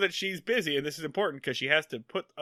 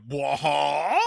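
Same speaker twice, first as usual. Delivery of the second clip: drawn-out cartoon cry of bewilderment; hammed-up wordless double take; mock baffled noise. Rich guy and lawyer glance at each other, mock bewildered noise. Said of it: "glance at each other like".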